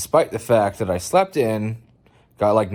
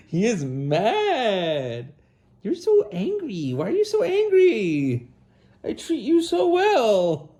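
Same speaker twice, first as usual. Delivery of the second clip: much higher sing-song pet voice; 11 semitones higher, slower than usual. Real, not acted. Owner cooing at the angry cat, high baby talk.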